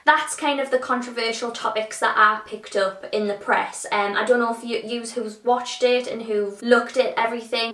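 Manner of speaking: ordinary talk, nothing marked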